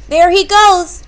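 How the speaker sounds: high, loud storybook yell. A narrator's reading. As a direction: yell out the sighting. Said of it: "Tess yelled".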